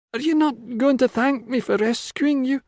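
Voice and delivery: put-on high-pitched child's voice; young boy. A narrator's reading. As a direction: ask as an expectant little boy, pitched high. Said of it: "Then the boy said".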